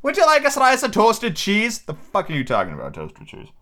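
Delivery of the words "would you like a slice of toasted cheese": put-on high, slow, eager old castaway; hammed-up high hermit voice; Ben Gunn voice. A narrator's reading. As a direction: offer it like a half-mad hermit.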